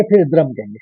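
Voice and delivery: ordinary talk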